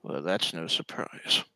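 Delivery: low, soft, resigned lich; lightly voiced Renwick the lich. Game master plays Renwick.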